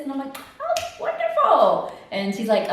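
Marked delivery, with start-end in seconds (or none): 0.6-1.9 very high, drawn-out delight; chirpy reply. Retold or imitated. Patient answers doctor's call about results, chirpy. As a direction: mirror the cheery caller, slow and high.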